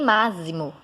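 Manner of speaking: "máximo" with X as a z sound, MA-zi-mo; wrong pronunciation. Shown as a demonstration. Teacher rules out a wrong reading, "máximo" mispronounced with z. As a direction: say "máximo" with a buzzing z in the middle. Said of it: "e nem máximo".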